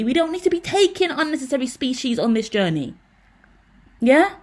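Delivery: exasperated, demanding agreement; irritated. Real, not acted. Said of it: "I'm irritated now".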